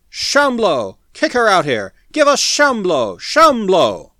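high, loud, insistent shouts; clamor for it like an angry crowd; mob clamor. A narrator's reading. Mob surging at Smith, loud demanding clamor. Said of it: "their clamor growing louder".

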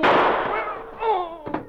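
sharp high yelp as the bullet hits; a sudden shot-through cry; cry of pain. Acted. Shot by Driscoll, Rocky cries out in pain.

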